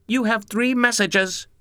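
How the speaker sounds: high, slow answering machine voice; robotic recorded female announcement; synthesized female voice. Acted. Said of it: "in its digitally synthesized female voice".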